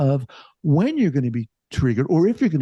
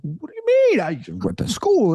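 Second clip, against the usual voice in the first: high-pitched retold indignation; mock-offended protest, voice leaping up; taken aback; 8 semitones higher than usual. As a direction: squeak it out as if affronted by the question. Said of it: "taken aback".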